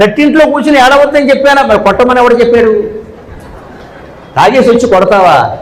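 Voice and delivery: quick, high imitated marital squabble; mock quarrel. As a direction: snap back as the bickering husband. Husband and wife quarrel acted.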